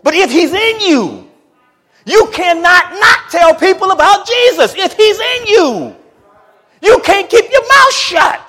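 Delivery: high, loud, drawn-out preacher's shout; shouted preaching. Real, not acted. Preacher insisting believers must tell about Jesus, shouted preaching. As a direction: cry it out slowly to the whole church.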